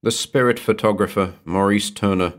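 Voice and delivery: ordinary delivery, nothing marked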